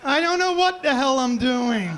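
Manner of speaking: normal talking voice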